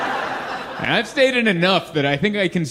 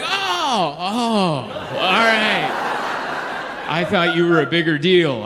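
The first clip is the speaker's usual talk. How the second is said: deflated surprise; slower than usual. Retold or imitated. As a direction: play a clerk whose excitement collapses. Clerk realizes he's not famous.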